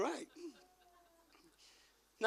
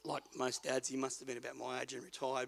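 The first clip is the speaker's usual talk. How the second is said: ordinary talk, nothing marked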